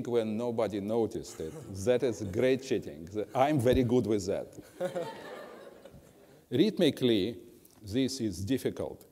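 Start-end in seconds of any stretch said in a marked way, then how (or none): 4.5-5.4 brief, breathy chuckle; quick self-amused laugh; short laugh. Real, not acted. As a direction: chuckle at your own joke. Laughs at own cheating joke.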